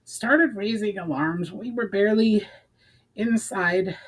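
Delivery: high, strained snarl of a short, breathless companion; lightly voiced little thief, indignant and winded. A narrator's reading. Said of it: "snarled the shortest".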